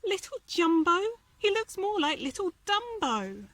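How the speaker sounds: mocking elephant voice. A narrator's reading. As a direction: taunt him like a snooty elephant. Elephant mocks Dumbo.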